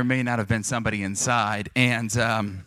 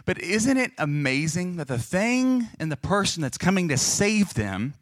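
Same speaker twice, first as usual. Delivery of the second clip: usual speaking voice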